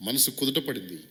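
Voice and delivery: ordinary talk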